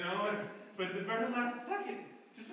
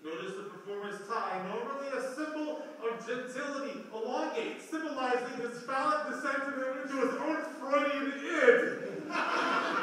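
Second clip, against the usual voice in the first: mock critic narration; 5 semitones higher, 5 dB louder than usual. Mock art-critic commentary.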